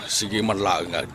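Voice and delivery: ordinary delivery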